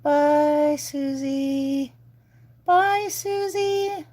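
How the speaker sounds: high, drawn-out cutesy farewell; sing-song goodbye. Real, not acted. Saying bye to Susie, high sing-song. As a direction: stretch out a bright high bye-bye.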